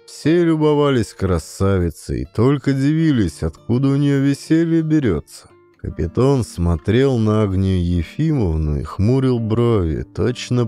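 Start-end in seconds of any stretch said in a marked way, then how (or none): none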